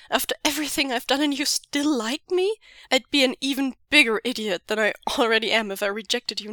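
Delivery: joyful tearful outburst; tearful outcry. Acted. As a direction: blurt it out overwhelmed. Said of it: "Wei Ying cries".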